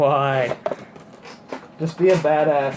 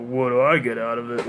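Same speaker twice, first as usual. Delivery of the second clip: imitating actor. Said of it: "but it was like".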